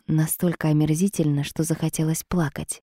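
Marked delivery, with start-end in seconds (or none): none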